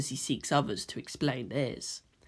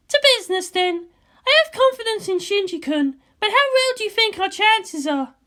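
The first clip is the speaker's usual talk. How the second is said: much higher, louder woman's voice; a tense commander on the eve of battle; Misato's voice; 4 dB louder than usual. Acted. Misato brooding to Ritsuko about tomorrow, high and loud.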